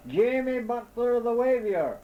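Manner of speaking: high, loud roar of his name. A narrator's reading. Lost man roaring his name at the owl, high and loud. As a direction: bellow your name into the trees. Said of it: "as loud as I could roar".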